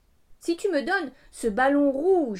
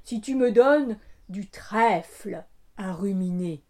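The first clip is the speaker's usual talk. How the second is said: cow voice; 4 semitones lower, 4 dB louder than usual. A narrator's reading. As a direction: voice a placid cow. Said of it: "répond la vache".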